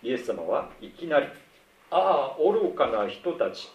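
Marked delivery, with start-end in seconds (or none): 1.9-3.7 louder, drawn-out ah, foolish ones; a slow, loud lament toward the disciples; Jesus exclaiming. Acted. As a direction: stretch it out slowly as an outcry. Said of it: "言っているんです".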